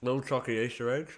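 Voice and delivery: talking through a mouthful of chocolate; words round a bite of chocolate; chewing while talking. Real, not acted. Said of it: "I'm sorry for talking while I'm eating".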